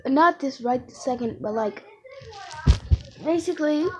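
ordinary talk

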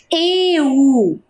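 "Eu" said clearly and a little drawn out; modelling "Eu" for dictation; sounding out a word. Shown as a demonstration. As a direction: say "Eu" slowly for children to hear. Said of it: "qual é o som que você tá escutando?".

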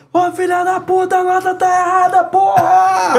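furious high-pitched shout from upstairs; yelling across the room. Retold or imitated. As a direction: bellow the correction at the student. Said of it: "aí o cara ligava assim". (gritando de cima, furioso)

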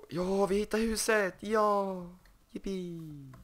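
high, joyful whoops; real gleeful yippee; excited cheer. Real, not acted. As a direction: celebrate finding the house. Finding the lost house.

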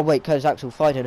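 normal talking voice

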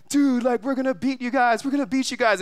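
high, cocky brag; lightly voiced fan bragging to rivals; mock boast. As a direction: boast back at the whole crowd. Rival fan answering the crowd.

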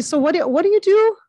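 loud, high, curious guy voice; punched-up, inquisitive impersonation; Ray Liotta. Retold or imitated. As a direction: imitate him asking with eager curiosity. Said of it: "he's genuinely curious".

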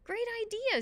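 put-on high, excited boy; eager agreement. A narrator's reading. Calvin takes off after Annabelle, eagerly excited. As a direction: jump on the idea, pitch way up.

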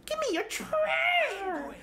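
high, slow squeaky kobold. Acted. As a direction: demand treasure like a greedy little kobold. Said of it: "I'm a good kobold guy".

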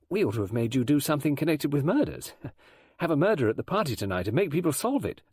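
put-on high young girl's voice; pushy schoolgirl; forward thirteen-year-old girl. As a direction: question the guest boldly, pitched high.

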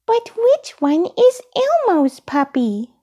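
high puppet voice, questioning; high childlike character; Elmo. A narrator's reading. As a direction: wonder aloud in a small high voice. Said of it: "Elmo asked out loud".

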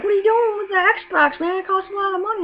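high-pitched imitated complaint; mock protest. Friends to guy who chucked Xbox, protesting. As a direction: object in a high, put-on voice.

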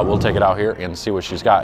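normal talking voice

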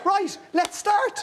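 bright, slow, cheery "let's start"; breezy coach. Retold or imitated. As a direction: sing it out like an untired athlete. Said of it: "And she goes".

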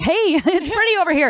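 high, bright forced cheer hiding tears; fake cheerful. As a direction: pretend all is fine, a touch too high. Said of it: "Trying to act like everything's okay".

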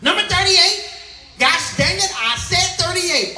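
high, loud irritated clerk calling out; angry woman. Acted. Grumpy clerk shouting the number at waiting customers, angry. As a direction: snap out the number like she's had vinegar for breakfast. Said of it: "some angry woman who had".

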